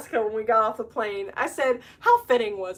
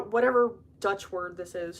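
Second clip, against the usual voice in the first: normal talking voice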